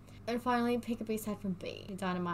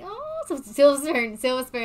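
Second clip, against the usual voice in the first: excited; 5 semitones higher, 11 dB louder than usual. Real, not acted. Excited pick of favourite.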